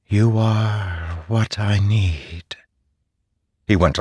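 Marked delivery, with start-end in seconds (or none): 0.1-2.6 soft, very slow airy voice; wind spirit. A narrator's reading. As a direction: whisper it like a distant wind. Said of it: "the wind's voice said softly".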